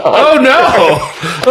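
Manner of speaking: high-pitched mock dismay through laughter; hammed-up, high cry of disbelief; acted shock. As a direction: recoil from terrible news, half laughing. Acted shock at death news.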